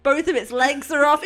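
ordinary talk, nothing marked

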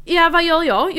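excited. Real, not acted. Retelling heroic cat rescue.

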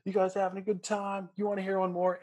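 hammed-up rock singer hyping the crowd, high; high, upbeat stage banter; mock frontman. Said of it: "was like".

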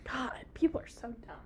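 whispered disgust; exasperated whisper. Real, not acted. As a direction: hush it, fed up. Exasperated aside, unvoiced.

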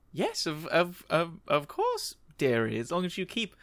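put-on high old lady with a cackling laugh. GM as Margaret teasing John, high laughing old-lady voice. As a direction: play a giggly granny, pitched well up.

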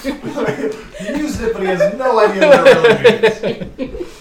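high and loud through laughter; laughing hard. Real, not acted. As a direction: crack up while teasing. Teasing a friend, laughing hard.

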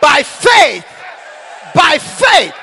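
loud, shouted preacher's proclamation; fiery preaching. Acted. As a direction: thunder it over the crowd. Preaching at full force.

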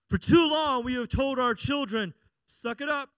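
high-pitched, emphatic sermon delivery; impassioned preaching. Real, not acted. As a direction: urge the congregation on. Preaches at full force.

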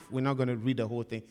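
ordinary talk, nothing marked

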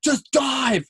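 high-pitched imitated team shout; urgent urging. Teammates yelling at the freshman, high urgent cry. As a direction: urge him on, pitched right up.

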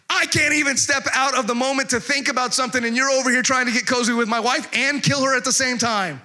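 high, sustained mock-furious tirade; outraged rant. King catching Haman with his wife, comic outraged rant. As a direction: pile it up in hammed-up disbelief.

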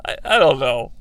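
usual speaking voice

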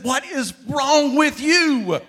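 loud, high, slow exasperated rebuke; slow loud disbelieving scold. Retold or imitated. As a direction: drag out each word in disbelief. Jesus rebuking disciples.

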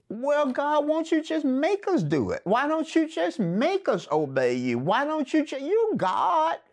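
high-pitched, acted-out pleading challenge; protesting to God. Voices people questioning God.

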